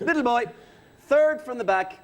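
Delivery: hammed-up veteran host lecturing the audience.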